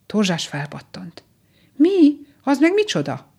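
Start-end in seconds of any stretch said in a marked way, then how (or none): 1.8-3.3 much higher, louder burst of surprise; astonished question. A narrator's reading. Rabbit hearing of the frog crossing, astonished. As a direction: pipe up in sudden amazement.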